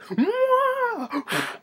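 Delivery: high, drawn-out mock wail; freaked-out monster cry. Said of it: "and he freaks out".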